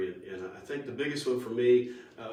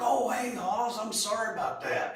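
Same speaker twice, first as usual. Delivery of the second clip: high-pitched, louder country-star impression; 11 semitones higher, 4 dB louder than usual. Country star answering a drummer who approached him, high mock holler. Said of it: "He goes".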